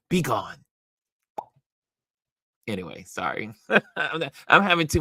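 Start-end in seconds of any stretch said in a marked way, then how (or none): none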